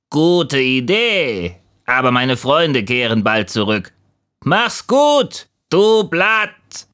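high, cawing bird voice; raven. A narrator's reading. Raven bids the lonely leaf farewell, high bird voice. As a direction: call it out brashly, leaping up high. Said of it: "setzte sich ein Rabe".

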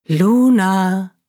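mysterious distant call of a name; eerie voice calling Luna; calling softly. Acted. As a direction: call her name from far away. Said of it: "Ganz leise und deutlich rief sie ihren Namen".

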